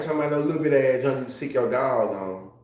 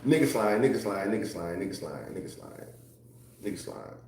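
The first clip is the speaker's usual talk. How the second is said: dropping lower and softer with each repeat; fading repetition; 4 semitones lower, 5 dB quieter than usual. Real, not acted. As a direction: repeat it, fading to a near whisper. Chanting a phrase, trailing away.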